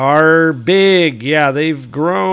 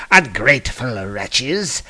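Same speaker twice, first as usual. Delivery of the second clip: angry Loki; 4 semitones higher than usual. Acted. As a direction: scold the ungrateful giants with mild mock fury. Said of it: "And he gets angry".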